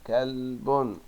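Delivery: "كلب" drawn out as "kalll-bun"; tanween damma ending. Shown as a demonstration. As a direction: sound out كلب with a held middle. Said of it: "الكلمات التالية بالضم".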